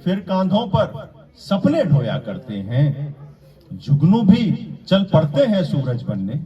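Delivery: measured declamation with pauses between phrases; mushaira-style verse recitation; poetry recital. Acted. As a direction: recite the couplet to an audience, landing each phrase. Recites ghazal couplets.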